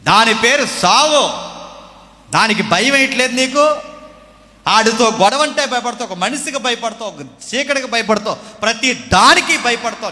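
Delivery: loud, building sermon warning of death; preaching at full force. Acted. Preacher warns about death. (గట్టిగా బోధిస్తున్న ప్రసంగ స్వరం)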